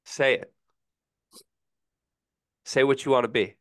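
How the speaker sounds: ordinary talk, nothing marked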